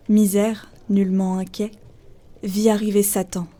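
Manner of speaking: ordinary talk, nothing marked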